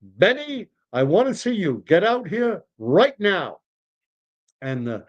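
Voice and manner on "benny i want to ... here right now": high, insistent summons; demanding old customer. Acted. Impatient diner summoning the deli owner, demanding. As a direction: call him over with swooping emphasis.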